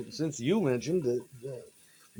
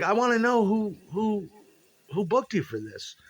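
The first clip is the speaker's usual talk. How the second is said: paranoid demand; 8 semitones higher than usual. Retold or imitated. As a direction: demand to know who booked them.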